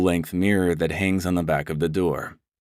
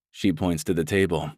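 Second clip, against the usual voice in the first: plain reading voice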